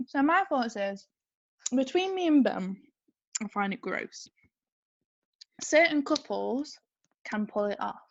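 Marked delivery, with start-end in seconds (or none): 3.4-4.3 chewing while talking; words round a bite of chocolate; mouth full. Real, not acted. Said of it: "mouth full of chocolate".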